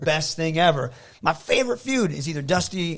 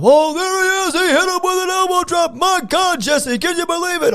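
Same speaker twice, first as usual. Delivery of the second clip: much higher, excited announcer call into a teddy-bear match; play-by-play announcer; 5 dB louder than usual. Retold or imitated. Boy voicing the announcer for his bear match, high and excited. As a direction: kid calling the match like a TV announcer. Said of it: "I would do all the promos and stuff".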